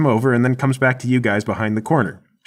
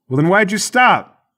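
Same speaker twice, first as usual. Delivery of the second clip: high, raised voice with a challenge; called-out question; 8 semitones higher, 4 dB louder than usual. Acted. Mike questioning his hidden partner, calling out. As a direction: holler it back at your partner. Guard yells back round the corner.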